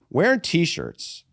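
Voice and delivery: ordinary talk, nothing marked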